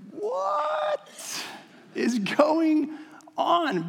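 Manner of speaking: a high, stunned "What" then trailing off; shocked disbelief. Pretend. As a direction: react as if you can't believe it. Imagined shocked reaction, chapter four.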